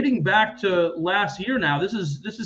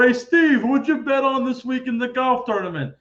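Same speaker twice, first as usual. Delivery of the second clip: higher, louder buddy calling out; imitating Barry; 7 semitones higher, 4 dB louder than usual. Retelling Barry hailing Steve about golf bets, mock brash call. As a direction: hail Steve in a brash friend's voice.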